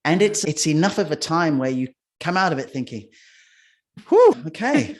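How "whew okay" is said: high, loud puff of relief; acted-out "phew, made it"; mock relief. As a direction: blow out a big breath, then a pitched-up okay. Imagined reaction on finishing hundred days.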